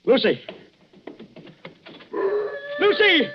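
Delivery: desperate call for a missing wife. Acted. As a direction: shout her name out across the water.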